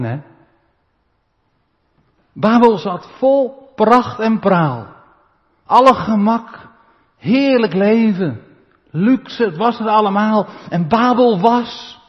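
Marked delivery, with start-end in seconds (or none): 2.3-12.0 loud, slow, high preaching; preaching at full force. Acted. Preacher describes Babel's luxury to the church, loud and high. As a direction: lay out Babel's luxury grandly.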